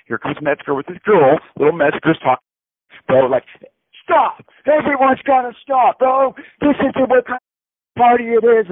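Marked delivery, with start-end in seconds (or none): 4.0-8.7 much higher, louder yell, slowed at the end; mock screaming friend. Metzger screaming at the mosh pit crowd to stop. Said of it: "And he's screaming".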